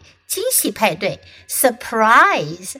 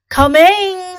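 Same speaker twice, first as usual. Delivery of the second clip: Bear voice; 7 semitones higher, 5 dB louder than usual. Acted. Bear voiced in read story.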